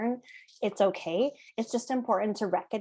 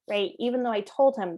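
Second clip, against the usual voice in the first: normal talking voice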